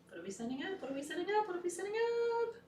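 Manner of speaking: building excitement. Real, not acted. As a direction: build the question to a high, loud peak. Rising repeated ritual question.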